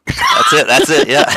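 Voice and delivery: high squeal of laughter; laughing. Real, not acted. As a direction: burst out laughing, very high and loud. Laughing agreement about fighting.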